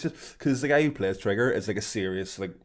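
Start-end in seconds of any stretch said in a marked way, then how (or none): none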